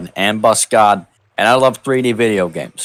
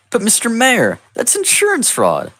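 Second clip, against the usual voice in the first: much higher, mock-shocked objection; mock protest; 13 semitones higher than usual. Hero objecting to the mayor, much higher mock shock. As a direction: squeak out a prim objection.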